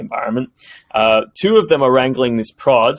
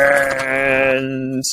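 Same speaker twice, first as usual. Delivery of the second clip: ordinary delivery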